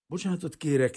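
narrated shivering stutter; chattering stammer. A narrator's reading. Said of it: "hebegte vacogó szájjal".

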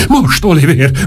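eager high plea, building up; coaxing him to act now, rising; urging. Acted. Ortrud coaxing Oliver, an eager rising plea.

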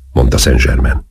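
ordinary delivery, nothing marked

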